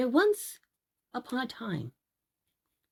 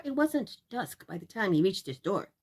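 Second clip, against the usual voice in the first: ordinary delivery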